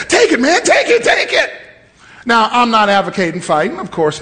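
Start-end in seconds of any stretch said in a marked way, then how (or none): 0.1-1.5 high pleading plea to take the ball; pleading boy. Retold or imitated. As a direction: beg him to take it, pitched up. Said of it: "he gave me the football".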